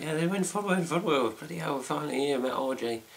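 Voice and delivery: high, quick, thrilled; excited. Retold or imitated. Man retelling his own thrill at finally reaching Fun World, high and quick. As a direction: gush like you finally made it. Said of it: "here's me like".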